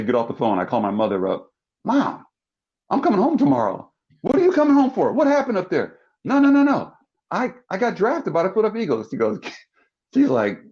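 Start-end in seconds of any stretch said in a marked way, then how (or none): 1.8-3.9 high-pitched excited phone call; retold eager, high voice; excited son. Son phones mother with news. 4.2-6.0 high, loud startled mom voice; retold high-pitched maternal alarm; alarmed mother. 6.2-9.1 high, hurried reassurance to mom; retold excited, high-pitched protest; urgent excited son. Son urgently reassures, announces draft.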